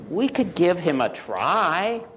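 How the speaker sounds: kinder hen. Acted. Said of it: "is a little kinder".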